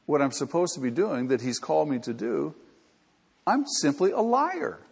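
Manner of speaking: ordinary delivery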